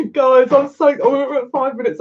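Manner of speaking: high, giddy outburst; excited, pitch jumping up. Real, not acted. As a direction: bubble over with real excitement. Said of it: "I'm so excited".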